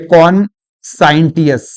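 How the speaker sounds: कॉन्साइंटियस slowly, "kon-sine-tee-us"; mistaken reading. Shown as a demonstration. Teacher voices the students' likely mistake, कॉन्साइंटियस slowly as kon-sine-tee-us. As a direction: sound a hard t in कॉन्साइंटियस. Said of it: "इसको कॉन्साइंटियस नहीं पढ़ते".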